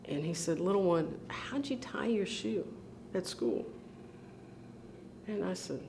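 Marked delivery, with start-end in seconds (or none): 0.6-3.6 gentle questioning. Retold or imitated. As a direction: imitate a big brother coaxing an answer. Brother gently questions the upset child.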